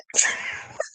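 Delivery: shrieking little laugh; high squealing laugh. Real, not acted. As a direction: squealy giggle. Squeal after the story.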